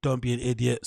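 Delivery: normal talking voice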